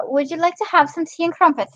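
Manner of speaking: lilting amateur British accent offering tea; attempted British accent. Shown as a demonstration. Host trying a best British accent for viewers, hammed-up British accent. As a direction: put on a British accent, slipping into Scottish. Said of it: "with my best British accent".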